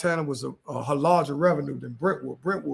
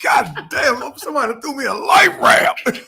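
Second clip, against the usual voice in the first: louder, higher, laughing through it; laughing relief; 6 semitones higher, 8 dB louder, slower than usual. Real, not acted. Telling the host how he got rescued, laughing loudly. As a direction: laugh out the relief of being saved.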